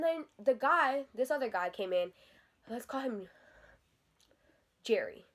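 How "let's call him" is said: ordinary delivery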